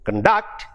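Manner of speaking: "conduct" as con-DUCT, reduced first syllable; stress on second syllable. Shown as a demonstration. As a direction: make "conduct" the verb, weight on DUCT. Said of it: "it becomes a verb".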